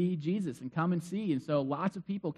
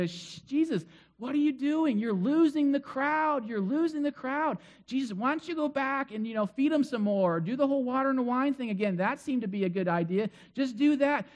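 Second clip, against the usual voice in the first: higher, urgent hushed pleading; anxious disciples; 5 semitones higher than usual. Retold or imitated. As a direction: pull Jesus aside before the crowd leaves. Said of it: "the disciples going".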